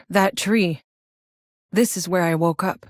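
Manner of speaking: ordinary talk, nothing marked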